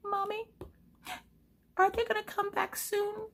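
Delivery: high-pitched, mock tearful child voice; squeaky hammed-up kid on the verge of tears; crying little daughter. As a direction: imitate a weepy little girl, high and small. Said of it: "who is uncontrollably crying as well".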